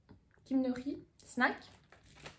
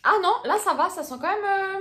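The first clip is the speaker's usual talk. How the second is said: loud disgusted outburst; 9 semitones higher, 8 dB louder than usual. Real, not acted. Reacting to a strong smell.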